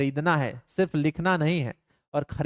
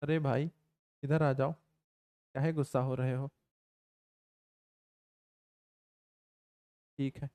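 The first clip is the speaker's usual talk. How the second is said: soft coaxing aside; 3 semitones lower, 7 dB quieter than usual. Real, not acted. Coaxing someone off-mic to calm.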